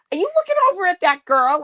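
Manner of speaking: high, quick accusing question; teasing, put-on possessiveness; mock jealousy. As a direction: play the jealous girlfriend. Acting jealous girlfriend to tease sister.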